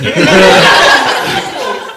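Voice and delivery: loud, high real laugh; big burst of laughter. Real, not acted. Speaker cracking up at his version of Peter, loud laugh. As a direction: burst out laughing at your own paraphrase. Laughs at own loose paraphrase.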